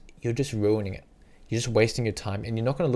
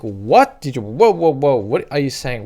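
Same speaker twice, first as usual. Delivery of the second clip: shocked local; 7 dB louder than usual. Pretend. Local shocked by foreign greeting.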